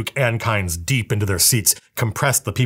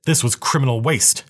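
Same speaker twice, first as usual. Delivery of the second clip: usual speaking voice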